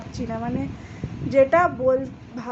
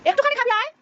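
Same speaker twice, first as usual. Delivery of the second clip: coaxing; 11 semitones higher, 5 dB louder, faster than usual. Real, not acted. Coaxing child to eat.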